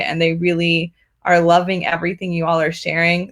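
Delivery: usual speaking voice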